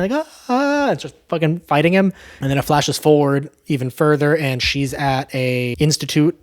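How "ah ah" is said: high-pitched mock scream; restrained high yelps, imitating a screamer; imitated scream. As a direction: scream in imitation, without full volume. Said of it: "starts screaming".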